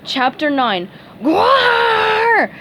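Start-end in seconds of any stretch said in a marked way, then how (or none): 1.2-2.5 high, loud monster roar; monster yell. A narrator's reading. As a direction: bellow it like a raging beast. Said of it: "Patrick yelled".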